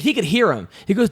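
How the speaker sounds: ordinary delivery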